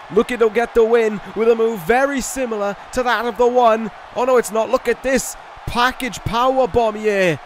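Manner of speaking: rising, excited ringside call; wrestling commentator. Acted. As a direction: build hype through the pin count. Live play-by-play, building to near-fall.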